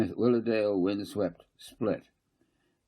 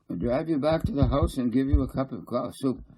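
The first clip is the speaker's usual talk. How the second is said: eager invitation; 9 semitones higher, 6 dB louder than usual. A narrator's reading.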